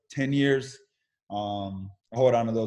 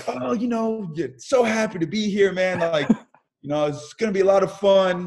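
put-on cheery phone voice, much higher; fake cheerful; 7 semitones higher than usual. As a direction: sell a happiness he doesn't feel. Faking happiness on the phone.

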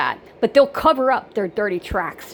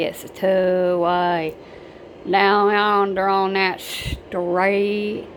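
very slow deep South Kentucky drawl; deep South Kentucky accent; much slower than usual. Shown as a demonstration. Showing real deep South Kentucky speech, very slow drawling accent. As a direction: stretch every vowel, deep Kentucky country. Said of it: "If it's real deep South Kentucky".

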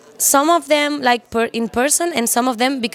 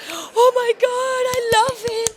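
excited squeal; 13 semitones higher than usual. Retold or imitated. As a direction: squeal it like a starstruck fan. Fan reacting in her room.

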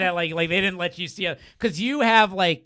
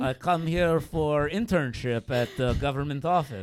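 slow, put-on Russian spy accent; mock Russian accent; 3 dB louder than usual. Comedian poses as a spy seeking an internship, mock Russian accent. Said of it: "You are hundred percent a Russian spy".

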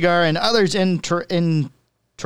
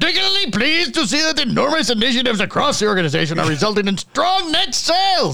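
higher, hammed-up German accent; 4 semitones higher than usual. Co-host keeps reading the statement in a mock German accent. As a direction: read it like a stiff German executive. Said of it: "He sounds German".

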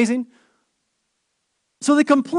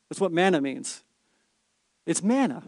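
ordinary delivery

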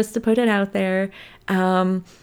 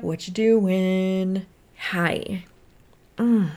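ordinary talk, nothing marked

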